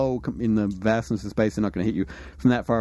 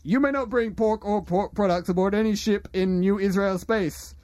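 stern ship captain; 10 semitones higher than usual. Acted. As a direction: order the intruders away. GM voices hostile captain.

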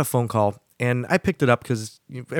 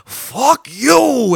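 sudden high furious yell; mock furious shout at the prankster; yelled curse; 13 semitones higher, 8 dB louder than usual. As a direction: explode at the caller. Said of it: "He just goes".

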